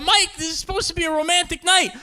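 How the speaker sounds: put-on high-pitched upset girlfriend; acted girlfriend's alarmed complaint; freaked-out girlfriend.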